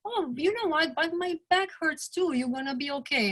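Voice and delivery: imitating the husband. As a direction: mimic him dodging with a shrug in the voice. Husband's retold reply, mimicked.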